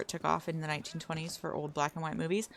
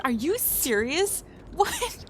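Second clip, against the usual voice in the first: surprised; 11 semitones higher, 4 dB louder, slower than usual. Real, not acted. Shocked reaction to chat.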